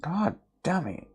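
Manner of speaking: ordinary delivery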